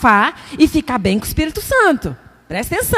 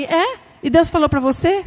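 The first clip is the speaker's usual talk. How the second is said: husband's question; 5 semitones higher than usual. Retold or imitated. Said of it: "Ele olhou pra mim e falou assim".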